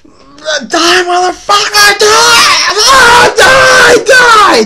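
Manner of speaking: very high, full-force frenzied scream; all-out high-pitched raging shriek. Acted. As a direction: scream it as if stabbing in a rage. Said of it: "Brander just started screaming".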